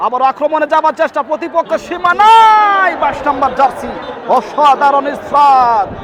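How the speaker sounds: loud, high excited play-by-play; frantic football commentary. Real, not acted. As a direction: build to a roar for the attack. Attack builds in live commentary.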